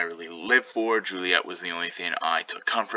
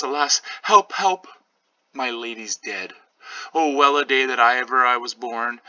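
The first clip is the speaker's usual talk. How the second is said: higher, panicked cries, help shouted louder; frightened nurse; 6 semitones higher, 3 dB louder than usual. Acted. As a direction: nurse discovering the body, crying for help. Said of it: "very frightful scene for the nurse".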